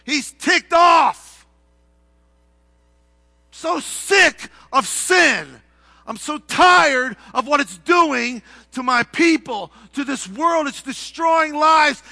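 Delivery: a preacher roaring God's exasperation with sin; ticked off. Acted. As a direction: hammer each phrase out in righteous fury. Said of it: "can I put it in our language?".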